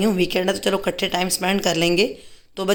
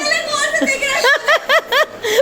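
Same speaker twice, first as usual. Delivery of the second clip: high laugh. Real, not acted. Family teasing at dinner table.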